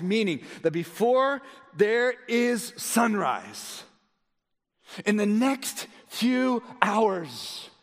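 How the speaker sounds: high, slow, weighty pulpit delivery; emphatic preaching. Real, not acted. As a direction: stretch the warning out for the congregation. Preaches at full force.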